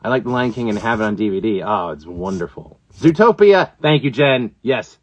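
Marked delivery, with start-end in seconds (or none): none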